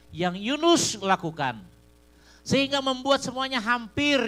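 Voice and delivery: loud, high preaching voice. Acted. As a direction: thunder the lesson at the church. Preacher at full force.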